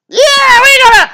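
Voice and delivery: put-on cracked falsetto old-timer squeal, very high and loud; old prospector. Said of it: "I'm picturing the old prospector".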